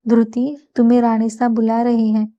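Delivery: usual speaking voice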